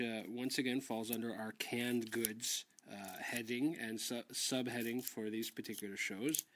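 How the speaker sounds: normal talking voice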